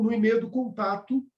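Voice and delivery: usual speaking voice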